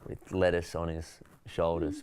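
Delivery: usual speaking voice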